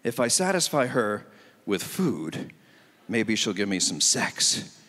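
single guy's thinking. Retold or imitated. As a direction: plot it out slowly, low and sly. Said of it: "So the thinking is".

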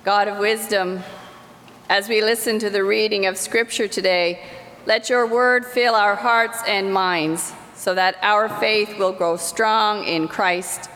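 ordinary delivery

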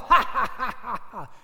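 loud, high jeering laughter; mocking soldier laugh. Soldiers laughing at the crowned prisoner, jeering cackle. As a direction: cackle at him in scorn. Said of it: "And then they laugh".

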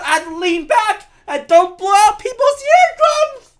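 high, loud, slow shouty rant; Bill Burr impression. Vlogger doing Bill Burr to vent, shouting rant. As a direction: bark it out like a ranting comic. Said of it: "Why am I talking like Bill Burr?".

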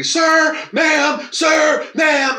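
students hailing the teacher who never knew names; imitating students calling out; mock calling out. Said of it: "Everybody would be".

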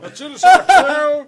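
laughing. Real, not acted. Laughing at the hat question.

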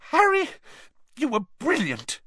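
very high squeaky voice. A narrator's reading. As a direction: squeak it out breathless with relief. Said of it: "Hermione said squeakily".

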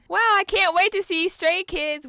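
high, loud gushing impression; mock Carolina. Teasing co-host imitating her, high and loud. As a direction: mimic your friend's fake excitement. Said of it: "What Carolina really means".

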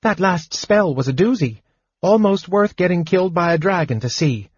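high-pitched, lightly rueful praise; rueful kender. A narrator's reading. Kender praising mage's last spell, mildly rueful. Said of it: "Tas said ruefully".

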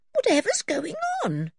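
high-pitched startled question. A narrator's reading. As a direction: ask it high, just jolted awake. Anne woken with a jump.

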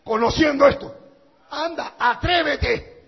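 loud, high, driving sermon shout; preaching at full force. Acted. Preacher warns the congregation against sin, full-force preaching shout. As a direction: thunder the warning at the congregation.

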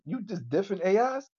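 ordinary delivery

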